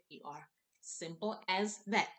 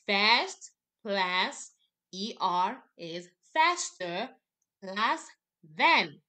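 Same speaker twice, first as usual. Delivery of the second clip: than called out loudest at the end; loud slow drill; 7 dB louder, slower than usual. Shown as a demonstration. Teacher drilling the class, loud and spaced. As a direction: chant it step by step, hitting than hard. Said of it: "let's do this one more time".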